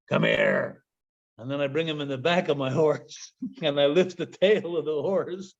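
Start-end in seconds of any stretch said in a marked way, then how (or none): 0.1-0.8 much lower, gruff mock-serious voice; Mountie deadpan. Mountie pointing out the horse's head to the driver, deep and gruff. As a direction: drop the voice deep and stern. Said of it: "I look at him and I say".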